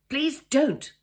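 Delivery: high, loud burst; loud, vehement plea. A narrator's reading. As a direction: cut him off with sudden vehemence. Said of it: "I said loudly".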